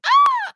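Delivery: high, loud shocked shriek; startled scream. Retold or imitated. Seeing Dan fall off the chair, a shocked shriek. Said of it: "I was like".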